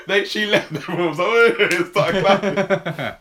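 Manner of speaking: high, loud telling through laughter; laughing. Real, not acted. Telling friend how woman got kicked out, laughing. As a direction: crack up while telling the story.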